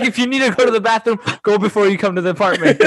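rapid mock rule. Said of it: "but it's like".

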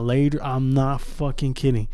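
ordinary talk

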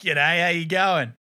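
mock Aussie greeting in a broad Australian accent. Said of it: "Yeah, just like that".

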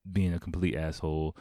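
ordinary talk, nothing marked

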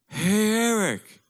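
high, slow drawn-out call across the room; dad calling out. Retold or imitated. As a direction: call out to your son like the old Cuban dad.

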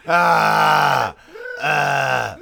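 loud, long open "ah" holler; loud drawn-out nonsense noise; making noises. Shown as a demonstration. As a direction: belt out a pointless held "ah". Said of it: "just be making noises".

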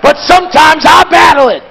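rising, shouted sermon climax; full-force preaching. Acted. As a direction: drive it home to the congregation.

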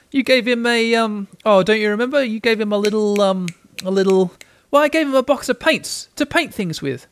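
high, hesitant voice; halting recall, then a high rush of certainty; child, searching memory. A narrator's reading.

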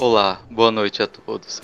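low, slow solemn greeting; putting on a grave tone; mock serious voice. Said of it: "Eu não consigo ficar sério assim".

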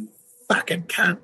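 high-pitched imitation of an irate British actor; hammed-up British street abuse; mock angry British accent. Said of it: "British accent".

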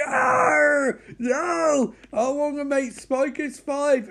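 high, loud mock scream of terror; panicked yell. Spike screaming as the plane crashes, high panicked yell.

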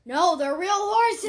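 loud, high, drawn-out objection; insistent protest. Real, not acted. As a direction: protest it loudly, stretching the words. Insisting against the other player.

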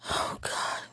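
usual speaking voice

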